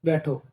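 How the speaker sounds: बैठो alone after a pause, falling; set apart by comma. Shown as a demonstration. Teacher finishes the comma example, बैठो standing apart. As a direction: say बैठो as its own short command, pitch falling. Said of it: "और यहाँ coma लगा दूं मैं".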